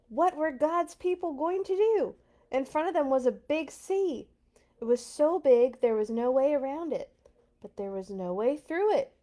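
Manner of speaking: breathless read-aloud suspense; tense storytelling. A narrator's reading. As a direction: build the danger for the kids. Dramatic story narration building tension.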